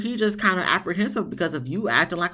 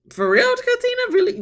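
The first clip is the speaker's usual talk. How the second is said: much higher and louder disbelief; incredulous; 4 dB louder than usual. Real, not acted. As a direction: squeal of can't-believe-it. Disbelief at crying wife.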